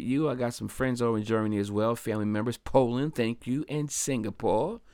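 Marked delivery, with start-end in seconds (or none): none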